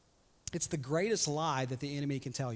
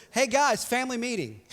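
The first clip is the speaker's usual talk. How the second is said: cheery Joseph; 7 semitones higher, 5 dB louder than usual. Retold or imitated. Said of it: "Joseph says".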